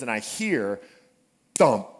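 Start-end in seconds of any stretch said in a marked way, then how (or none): none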